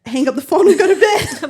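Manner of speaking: amused echo. Real, not acted. Wife joking about leaving husband to it, laughing. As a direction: crack up while echoing a friend's line.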